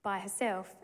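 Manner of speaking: ordinary talk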